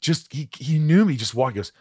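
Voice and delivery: usual speaking voice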